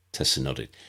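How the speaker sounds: plain reading voice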